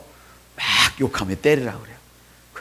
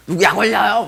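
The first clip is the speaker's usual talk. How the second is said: put-on sulky child; 7 semitones higher, 5 dB louder than usual. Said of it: "막 이러면서".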